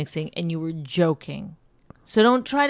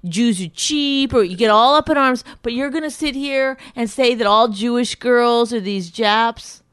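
heated, raised-voice accusation; real irritation; 4 semitones higher, 4 dB louder than usual. Real, not acted. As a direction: argue back, upset and indignant. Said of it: "I get mad because you say these things".

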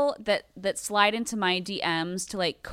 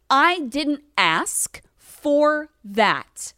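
slow emphatic; 5 semitones higher, 5 dB louder, much slower than usual. Real, not acted. Ranting about followers.

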